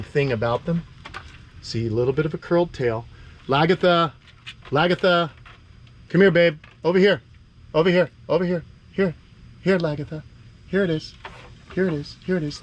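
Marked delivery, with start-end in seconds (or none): 3.5-9.1 high, loud sing-song call to a pet. Real, not acted. Calling lizard to food. 9.6-12.6 coaxing a pet. Real, not acted. Coaxing lizard gently.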